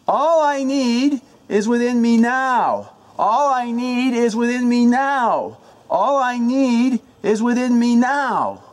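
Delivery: high, loud, slow incantation with "now" held long; shout, stress on now. Shown as a demonstration. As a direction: keep it soaring right through to a belted "now". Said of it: "the emphasis of each word".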